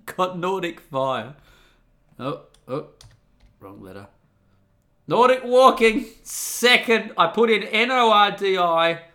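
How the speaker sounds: normal talking voice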